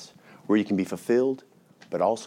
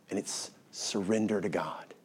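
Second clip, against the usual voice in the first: whisper; 3 semitones lower, 10 dB quieter, slower than usual. Real, not acted. Quiet sermon turn.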